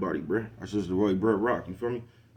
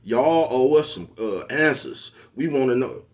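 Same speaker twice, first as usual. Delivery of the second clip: mock demanding bystander; 5 semitones higher, 4 dB louder, much slower than usual. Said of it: "look at the camera and talk about".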